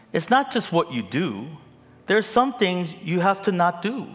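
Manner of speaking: loud, high rising sermon climax; fervent pulpit crescendo; preaching at full force. Acted. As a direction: build to a shouting preacher's peak.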